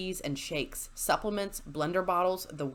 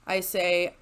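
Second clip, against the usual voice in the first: ordinary delivery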